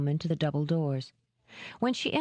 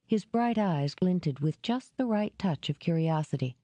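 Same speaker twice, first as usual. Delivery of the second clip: usual speaking voice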